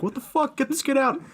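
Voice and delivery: high, incredulous mock host outrage. Imitating Jimmy Kimmel's mock outrage, high and squeaky. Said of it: "and he's like".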